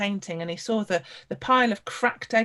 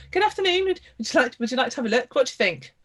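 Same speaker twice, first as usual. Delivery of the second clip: higher, louder, ingratiating welcome; smooth artist's greeting; 6 semitones higher, 5 dB louder, faster than usual. Acted. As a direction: play the oily painter inviting a look. Smug artist greets visitors.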